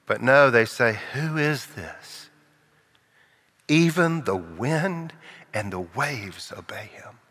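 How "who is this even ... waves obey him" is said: slow, hushed, breathless awe; awed whisper. Acted. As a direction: whisper in amazement. Awed disciples after calm.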